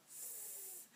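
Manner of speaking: "f-" hissed on its own; isolated sound. Shown as a demonstration. Teacher isolates the beginning sound of "fizz". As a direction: hold a lone "f-" sound. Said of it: "there's a beginning sound".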